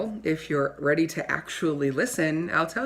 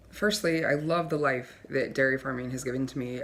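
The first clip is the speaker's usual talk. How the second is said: ordinary talk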